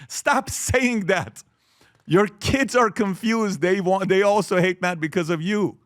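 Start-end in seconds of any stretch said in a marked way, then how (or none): 0.1-3.6 slow, weighted command; slow firm plea. Real, not acted. Speaker scolding parents about math, slow and firm. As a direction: press each word home.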